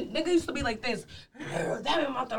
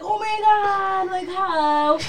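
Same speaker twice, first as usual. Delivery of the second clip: high, very slow drawn-out amazement; amazed gasp; 6 semitones higher, much slower than usual. Retold or imitated. Retelling being stuck at the fence, slow astonished drawl. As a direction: stretch out the disbelief, pitched up. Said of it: "And I used to be like".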